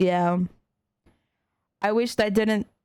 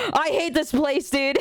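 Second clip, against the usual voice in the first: laughing through the words; real laugh breaking into a complaint; 4 semitones higher than usual. Real, not acted. Streamer laughing to chat about hating the internet.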